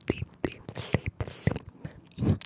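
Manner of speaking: sleepy repeated ASMR trigger; whispered trigger word. Shown as a demonstration. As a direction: lull them with each sleep. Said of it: "enough to fall asleep".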